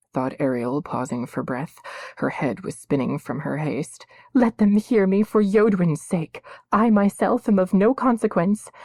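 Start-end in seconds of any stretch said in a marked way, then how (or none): 4.3-8.7 high, loud pleading prayer; girl praying for justice; desperate prayer. A narrator's reading. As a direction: plead with the gods.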